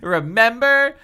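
high, loud, rising question; angry taunt. A narrator's reading. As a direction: fling it as a pointed challenge. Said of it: "her eyes burning angrily".

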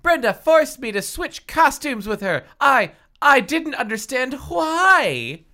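very high, loud wailing protest; distraught cry. Acted. Halle explains costume switch over body, distraught cry. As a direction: plead in overwrought, high voice. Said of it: "Halle cried, her eyes on Brenda".

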